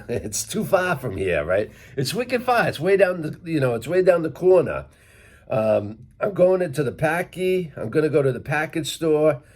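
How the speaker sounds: wicked-far Boston guy chatter; Worcester accent. Shown as a demonstration. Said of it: "But I say it, like, more from Worcester".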